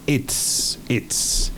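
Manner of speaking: "it's" said unvoiced, no vowel between t and s; clipped contraction. Shown as a demonstration. Teacher modelling the contraction "it's". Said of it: "Esa es la contracción".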